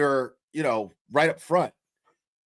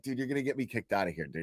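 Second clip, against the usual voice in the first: hushed plea; 4 semitones lower, 8 dB quieter, faster than usual. Retold or imitated. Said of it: "I was like".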